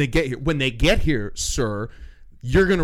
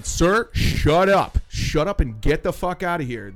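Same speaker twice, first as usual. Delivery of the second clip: stern order; 4 semitones higher, 7 dB louder than usual. Retold or imitated.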